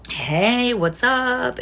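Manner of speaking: ordinary talk, nothing marked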